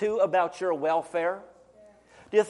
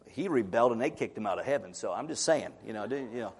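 lower, softer, quick aside; sly aside; 9 semitones lower, 7 dB quieter, faster than usual. Real, not acted. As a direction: drop it low like a secret. Aside hinting at the devil.

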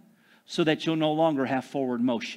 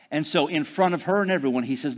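ordinary delivery

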